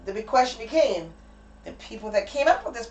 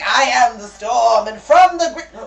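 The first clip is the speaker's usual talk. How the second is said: slow, loud mock trailer voice; hammed-up ad trailer; 8 dB louder, slower than usual. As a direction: imitate the booming ad opening slowly. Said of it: "And then the beginning, like".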